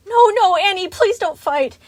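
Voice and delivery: high, distressed begging; whimpered plea. A narrator's reading. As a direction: whimper for them to stop fighting. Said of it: "Chica whimpered".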